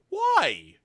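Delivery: very high, loud mock yelp; hammed-up indignant squeal; emotional outburst. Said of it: "in your emotional state".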